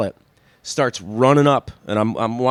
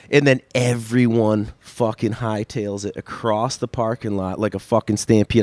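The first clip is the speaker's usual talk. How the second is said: ordinary talk, nothing marked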